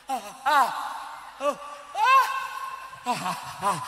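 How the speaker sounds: mock cries of pain from a teething bite. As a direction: yelps of a nursing mother being bitten. Baby teething, mother in pain. (rintihan kesakitan ibu menyusui)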